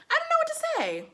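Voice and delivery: very high-pitched helplessness; squealed bewilderment; baffled. Real, not acted. At a loss, first take.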